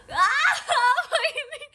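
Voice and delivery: loud, high, drawn-out wail; shocked wail. Real, not acted. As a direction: cry out in high, stretched disbelief. Shocked at what chat reports.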